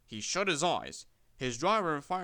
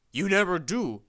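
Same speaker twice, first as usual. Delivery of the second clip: louder, stern reproach; 5 semitones higher, 8 dB louder than usual. A narrator's reading. Thin Controller scolding Sir Handel, stern and louder. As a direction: scold him firmly. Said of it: "said a stern voice".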